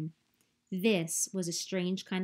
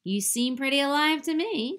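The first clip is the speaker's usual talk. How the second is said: high and loud, playful skunk; cheerful critter teasing the robot; friendly skunk voice; 7 semitones higher, 6 dB louder than usual. A narrator's reading. Skunk character voice.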